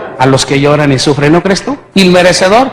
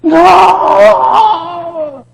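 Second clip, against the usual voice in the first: high, loud crying shout of "No"; desperate wail, much higher; anguished cry; 13 semitones higher, 3 dB louder than usual. Retold or imitated. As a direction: scream against the sight.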